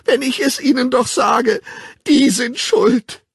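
hoch, laut, schluchzend herausgeschrien. A narrator's reading. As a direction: unter Tränen beteuern. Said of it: "schluchzte er". (schluchzend und schreiend)

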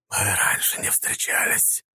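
slow, rustling whisper. A narrator's reading. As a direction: whisper it slowly like dry leaves. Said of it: "прошелестел голос из-под капюшона".

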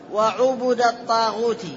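وعبد said wa-'ubuda, two short u sounds; u-u-a in وعبد, i ending الطاغوت; damma on ayn and ba. Shown as a demonstration. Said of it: "بضم العين والباء وفتح الدال".

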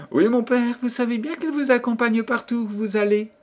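very high, sweet princess voice; dutiful princess. A narrator's reading. Princess answering her father the king, dutiful. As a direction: answer high, as a devoted daughter.

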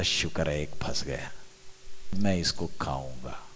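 low, understated mutter to himself; lightly voiced fisherman. Preacher voices a fisherman pleased with one catch, lightly acted, low. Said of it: "वो बोलता".

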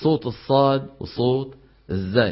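ordinary talk, nothing marked

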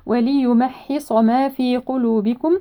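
slow recitation, wa-li-yumahhisa for وليمحص; clear kasra on the lam. Shown as a demonstration. Teacher reciting for students, distinct li in وليمحص. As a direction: give li in وليمحص its own syllable. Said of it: "لام مكسورة نحقق الكسرة".

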